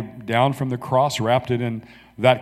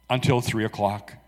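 ordinary delivery

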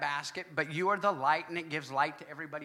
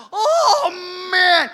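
loud high cry of delight; excited outcry; 11 semitones higher, 15 dB louder than usual. Real, not acted. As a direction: cry out over the flavor. Raving about a taco.

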